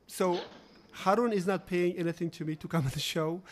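ordinary talk

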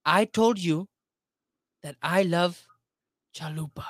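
badly faked Latin accent, closer to Indian; halting, punchy attempt at a Latin accent. Shown as a demonstration. Auditioning for a Latin radio station, shaky-grasp Latin accent. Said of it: "Give me a Latin".

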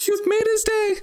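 squeaky, very high mock weepy mother; hammed-up happy tearfulness, voice way up high; tearful mom. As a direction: imitate a mom overcome with joy. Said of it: "his mom was tearing up".

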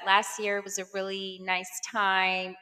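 ordinary talk

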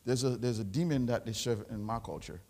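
ordinary delivery

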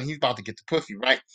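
ordinary talk

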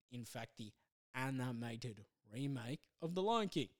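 ordinary talk

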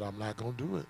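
low, quiet voice of God; quiet, unbothered refusal from God; God's reply. Acted. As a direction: answer him softly and firmly as God. God answers Paul, voiced low.

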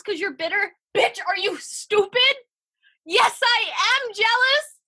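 ranting at jealous listeners, building to a yell; full-on rage rant. Real, not acted. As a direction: build from loud to a furious outburst. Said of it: "full on rage".

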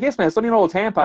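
brisk mock impatience; impatient retort. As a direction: hurry it out, fed up.

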